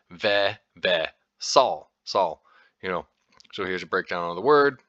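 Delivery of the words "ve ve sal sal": "ve, ve, sal, sal" broken into slow syllables; Old Norse vasal. Shown as a demonstration. Teaching the Old Norse word to listeners, syllable by syllable. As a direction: sound out "ve" then "sal", each twice. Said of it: "is pronounced approximately as".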